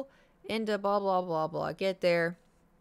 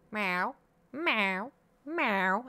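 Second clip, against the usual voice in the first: cat meow; much slower than usual. Retold or imitated. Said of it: "and he goes".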